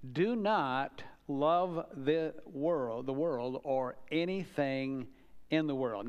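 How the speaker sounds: each word of the verse laid out slowly and deliberately; slow solemn reading. Acted. Preacher reading the verse slowly and gravely to the congregation. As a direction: let the verse sink in, word by word. Slow scripture read, then repeated.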